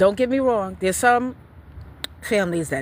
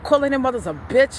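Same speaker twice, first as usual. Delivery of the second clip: usual speaking voice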